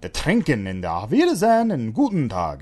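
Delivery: German accent. Shown as a demonstration. Showing what he picked up from Rosetta Stone, hammed-up German accent. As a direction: hit the words with an exaggerated German accent. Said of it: "a little bit of an accent".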